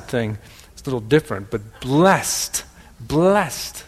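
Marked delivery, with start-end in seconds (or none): 1.8-3.9 "blessed" as blest in a light Wisconsin accent; a hint of Wisconsin on "blessed", said blest. Shown as a demonstration. Joking about his home accent, "blessed" as blest. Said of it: "that's my Wisconsin accent".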